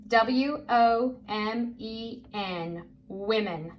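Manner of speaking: slow, letter by letter, then the word; measured spelling drill, each letter apart. Shown as a demonstration. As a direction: spell it slowly for a young reader. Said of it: "I'm gonna do it myself".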